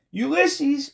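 high-pitched calling cry; very high, only moderately loud yell; understated scream. A narrator's reading. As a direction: scream the name with some restraint. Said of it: "screamed Flora".

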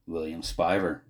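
ordinary delivery, nothing marked

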